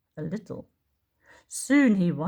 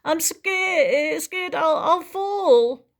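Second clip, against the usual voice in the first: frightened Tyro; 10 semitones higher, 6 dB louder, much slower than usual. Acted.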